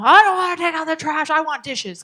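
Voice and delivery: put-on high, loud whining kid refusing; whiny child. Parent voicing a child who refuses the trash: loud whiny protest. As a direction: whine it like a stubborn kid.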